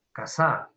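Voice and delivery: "Casa" stressed on the last syllable, ca-SÁ; wrong stress on SA. Shown as a demonstration. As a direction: hit the end of "Casa" as casÁ. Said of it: "Yo voy a ridiculizar la palabra". ("Casa" con acento exagerado al final, casá)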